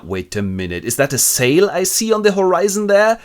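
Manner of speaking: ordinary talk, nothing marked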